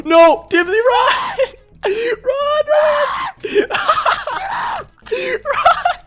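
very high screamed warnings; panicked shrieking. Real, not acted. Player urges Dibsy to run from a team, panicked shrieking. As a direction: scream for them to flee. Fleeing enemies in game.

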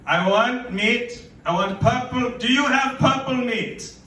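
high, loud pushy customer voice; demanding lady customer. Retold or imitated. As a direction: mimic a shrill old lady demanding meat. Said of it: "And she's just like".

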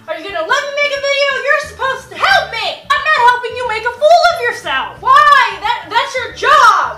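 loud high-pitched bickering; heated argument. Real, not acted. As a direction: bicker loudly with your sibling. Siblings arguing loudly.

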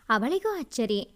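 plain reading voice

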